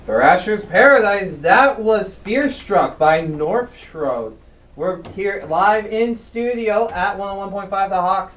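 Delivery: slow, loud, smooth on-air back-announce; radio announcer voice. Real, not acted. Host back-announcing the band on air, radio DJ voice. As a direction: roll it out like a DJ on the mic. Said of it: "It's the sexy radio voice".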